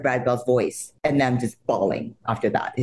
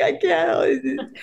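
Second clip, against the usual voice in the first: laughing through tears. Retold or imitated. Crying, sister scolds her.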